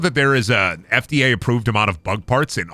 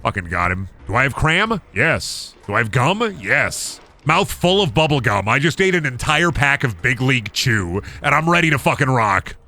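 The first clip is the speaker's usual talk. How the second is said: ordinary delivery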